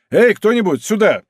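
loud high call across the courtyard. A narrator's reading. D'Artagnan calls his musketeers, loud. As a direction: summon the guards, raising the voice.

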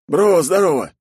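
put-on high, loud eager young guy; bright friendly shout of greeting; excited buddy voice. Snegir voiced by narrator.

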